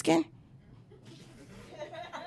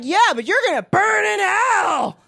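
higher, slow mock damnation; mock preacher; 6 semitones higher, slower than usual. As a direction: wail the threat like a zealot. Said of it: "They're like".

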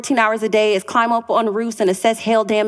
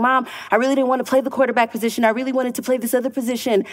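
put-on rushed disappointed kid; much faster than usual. Disappointed son in back seat.

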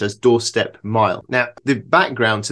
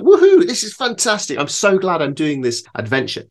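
much higher, bursting with mock joy; cheerful whoop; 7 semitones higher than usual. Setting off down the road, mock-jubilant. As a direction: whoop it out, then gush. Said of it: "I'd be like".

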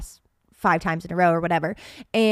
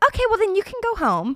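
mock nurse; 11 semitones higher, faster than usual. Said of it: "And they're like".

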